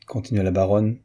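plain reading voice

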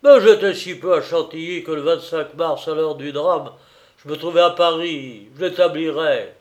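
heated, emphatic denial; angry outcry. A narrator's reading. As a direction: exclaim it in an angry tone. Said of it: "s'écriait sur un ton de colère".

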